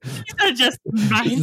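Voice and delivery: slow, put-on posh accent copying the quote; mock posh accent. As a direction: drag out the fancy accent. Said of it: "what accent that was".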